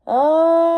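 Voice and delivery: short, sharp beast roar; a quick roar in the tunnel; monster roar. Acted. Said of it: "it came again".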